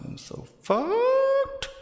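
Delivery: high, loud, stretched-out wail of despair; anguished wail. Acted. Actress wailing to herself that she's doomed, high and drawn out. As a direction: wail it like the world is ending.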